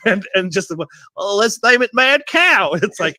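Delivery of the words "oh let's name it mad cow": mock dumb scientist. Mocking scientists naming disease.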